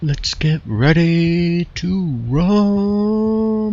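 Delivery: slow, drawn-out, high boxing announcer; ring announcer voice. Pretend. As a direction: stretch every word like a ring announcer. Mock ring announcer call.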